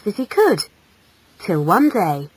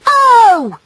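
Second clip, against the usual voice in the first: high, loud female outrage; sharp burst of annoyance; angry cry; 8 dB louder than usual. Acted. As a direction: cry out indignantly. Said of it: "cried the female angrily".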